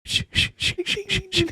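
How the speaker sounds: breathy shh sound, rising high; hushing effect. Retold or imitated. Mimicking a film's creepy hush.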